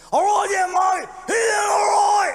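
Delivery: put-on high, loud slurred drunk pirate voice; raspy drunk Aussie. Comic impersonating a raspy drunk Aussie at the pub, high loud slur. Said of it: "He had more of a raspy voice".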